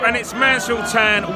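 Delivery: the score yelled out loud and slow; shouted score. Real, not acted. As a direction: bark out the bad news, slowly and loud.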